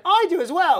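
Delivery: high, loud mock heckler; shrill-voiced heckler insisting.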